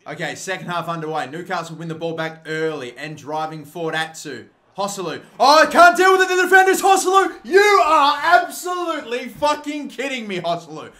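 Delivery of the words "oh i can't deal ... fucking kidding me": real exasperated yell at a player; furious shout. Real, not acted. Commentator berating Joselu for another miss, furious shout. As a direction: explode loud and high in disbelief.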